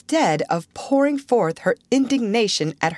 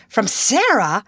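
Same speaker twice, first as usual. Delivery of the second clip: louder, astonished question; surprised exclamation; 4 semitones higher, 4 dB louder than usual. Acted. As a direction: gasp out the name in surprise. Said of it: "every voice joined in that exclamation".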